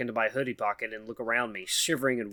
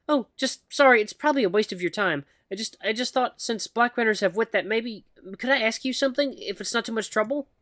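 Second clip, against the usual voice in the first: higher, halting nervous voice of a shy mouse; timid mouse woman; 9 semitones higher than usual. Acted. Shy mouse woman nervously calls to the human, halting and high. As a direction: stammer it out like a timid mouse calling for attention. Mouse hesitantly asks favour.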